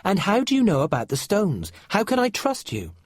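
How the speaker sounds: put-on a boy who won't trust easily; suspicious boy. A narrator's reading. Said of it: "asked Finn again".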